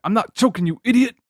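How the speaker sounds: high, loud, annoyed; exasperated shout. Retold or imitated. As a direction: yell it at a fool. S1 acts the movie line.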